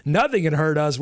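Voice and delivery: ordinary talk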